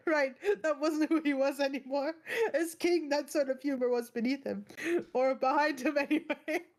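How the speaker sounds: ordinary delivery, nothing marked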